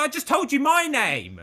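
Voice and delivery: high, loud exasperated shout; angry shout. Retold or imitated. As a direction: shout it back, fed up. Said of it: "I was so angry".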